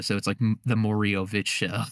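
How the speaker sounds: ordinary talk, nothing marked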